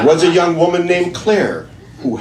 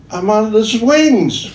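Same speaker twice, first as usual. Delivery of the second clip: put-on slow, higher, louder drunk; drunk punchline voice; 10 semitones higher, 5 dB louder, slower than usual. Drunk answering the man from the swings, slow and loud. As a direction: drag it out like a happy drunk. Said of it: "The drunk says".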